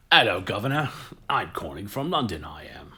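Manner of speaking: loud, hammed-up mock Cockney greeting; bad English accent. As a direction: open big with a terrible fake London accent. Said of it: "they'll just do a really bad, like, accent".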